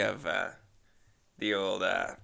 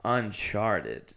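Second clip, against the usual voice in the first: usual speaking voice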